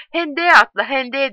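high, urgent narrated cry; shouting. A narrator's reading. Bruno urging Tipo into the ditch, shouting high. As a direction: cry out a desperate command. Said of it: "diye haykırıyor".

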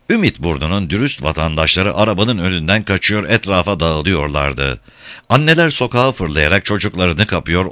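ordinary delivery, nothing marked

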